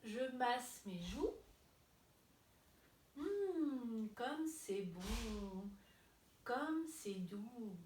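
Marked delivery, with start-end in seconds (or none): 3.2-7.8 slow, drawn-out contented hum and words; soothing, savouring. Pretend. Teacher massages cheeks with the children, slow and savouring. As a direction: linger on each word with pleasure.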